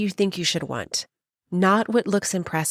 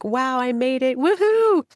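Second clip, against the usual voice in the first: mock celebration; 7 semitones higher than usual. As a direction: act out an over-the-top victory whoop. Imagined celebration of reaching a goal.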